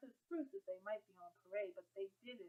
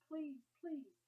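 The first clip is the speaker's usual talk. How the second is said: pleading; 7 semitones higher than usual. A narrator's reading. Begging brother to stay.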